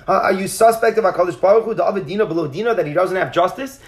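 ordinary delivery

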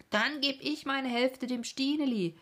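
hoch und laut gerufen; rufend. A narrator's reading. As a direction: ruf es begeistert heraus. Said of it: "rief Silvio". (Silvio ruft es freudig, hoch und laut)

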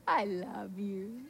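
low, soft and slow; tender reply. Retold or imitated. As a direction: answer the pleading with quiet love. God's gentle answer to her plea.

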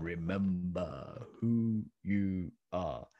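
slow, distant solemn voice; Mufasa. Retold or imitated. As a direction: voice from the clouds, very slowly. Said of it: "if you need that image again".

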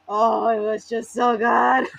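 loud, drawn-out emotional gush; overwhelmed and stretched out. Real, not acted. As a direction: gush it slowly, laugh on the edge of tears. Gushing over emotional moment.

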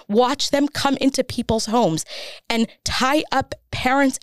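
real emotion rising into indignation; very emotional. Real, not acted. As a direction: let the outrage build as you testify. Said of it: "I'm gonna get very emotional right now".

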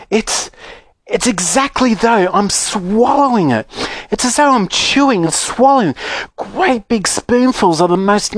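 high-pitched, delighted relishing; rapturous girl. Acted. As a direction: savour each mouthful with excited wonder. Violet delighting in the magic gum.

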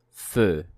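Feu with a closed rounded vowel; model word. Shown as a demonstration. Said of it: "Encore une fois".

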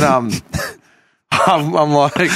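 ordinary delivery

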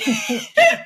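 high, loud laughing burst; real loud laugh; burst of laughter. Real, not acted. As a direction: crack up at the joke.

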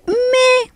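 high, drawn-out "meh" of boredom; unimpressed "meh". Retold or imitated. Host showing listeners how boring the songs felt, bored meh. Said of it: "Y así como que".

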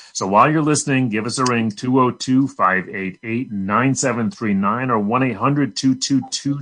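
ordinary talk

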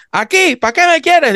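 high, far-off called reply; imitating the grandfather answering his wife; grandfather calling back.

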